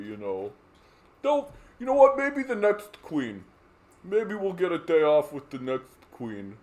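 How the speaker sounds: hammed-up grudging acceptance; mock resigned. Citizens settling for a holiday, sarcastic resignation. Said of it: "we're all just supposed to be like".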